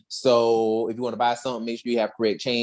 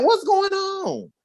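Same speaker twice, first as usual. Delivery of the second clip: surprised Zach; slower than usual. Retold or imitated. Zach voiced, high, slow.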